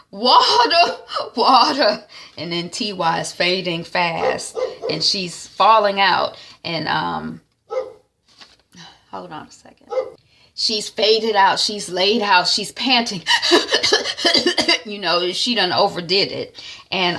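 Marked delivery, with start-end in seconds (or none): 0.1-2.0 high laughing cry for water; mock desperate gasp for water. 13.3-14.8 high burst of laughter; real laugh at the story; loud amused laugh. Real, not acted.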